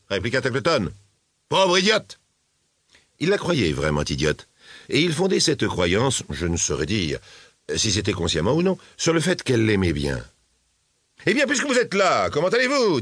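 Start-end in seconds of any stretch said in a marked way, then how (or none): 1.5-2.2 high, exclaimed "poor idiot" insult; mépris lancé. A narrator's reading. Tackleton insulte la jeune aveugle. 11.3-13.0 grudging greeting in a raised pitch; mauvaise grâce. A narrator's reading. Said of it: "avec sa mauvaise grâce habituelle".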